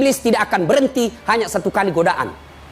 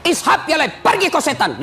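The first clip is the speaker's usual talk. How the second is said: high, sharp banishing command; acted rebuke; 7 semitones higher than usual. Pious dying man sends Satan away, rebuking. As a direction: drive the devil off firmly.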